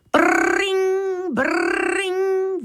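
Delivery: high, rolled brrring like a ringing phone; phone ring imitation. A narrator's reading. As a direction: ring it out like an old telephone. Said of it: "the phone was ringing".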